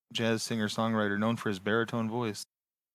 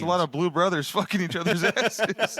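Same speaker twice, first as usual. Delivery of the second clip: laughing; 5 semitones higher, 3 dB louder than usual. Real, not acted. Laughing description.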